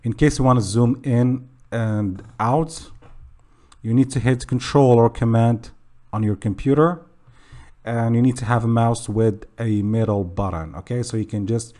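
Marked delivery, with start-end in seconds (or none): none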